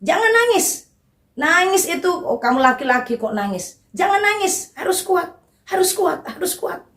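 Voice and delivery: playful impression of a scolding parent. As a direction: imitate a stern father forbidding tears, pitch up. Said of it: "Karena dari kecil dibilang".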